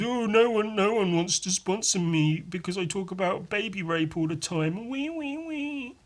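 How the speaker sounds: mock your own whining like a crybaby; mocking himself complaining about sponsors; whiny complaining. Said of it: "Complaining 'cause".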